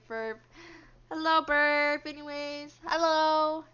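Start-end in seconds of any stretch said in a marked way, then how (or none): 1.1-3.6 loud greeting. Real, not acted. Calls out greeting to a chatter.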